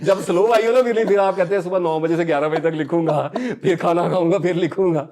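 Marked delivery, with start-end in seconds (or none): 1.6-5.1 loud, higher mocking of a planned writing day; mock writer's plan. Mocking a leisurely writer's schedule.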